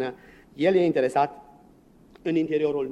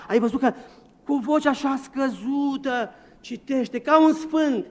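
mock awe; 6 semitones higher, 7 dB louder, slower than usual. Parodying admiring churchgoers.

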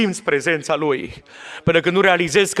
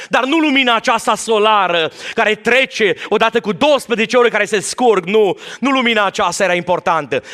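preaching; 5 semitones higher than usual. Acted. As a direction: preach it out to the congregation. Preaching at full force.

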